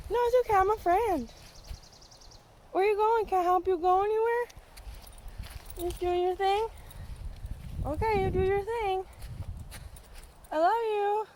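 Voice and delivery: high-pitched pet talk; cooing to an animal. Real, not acted. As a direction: coo it high, reassuring a shy turtle. Cooing to a turtle it meets.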